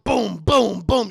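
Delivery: loud punched booms; gunshot imitation. Storyteller imitates Karen's shots, loud booms. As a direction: fire off three vocal shots.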